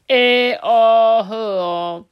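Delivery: short loud bully chuckle; loud menacing huff; gruff wolf laugh. Acted. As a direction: grunt a laugh like the boss wolf.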